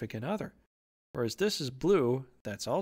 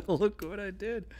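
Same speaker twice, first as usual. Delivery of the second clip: higher, boastful call with a laugh before it; rooftop shouting; 6 semitones higher than usual. Acted. Imagined bragger telling everybody, playful shout. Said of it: "shouting on the rooftops".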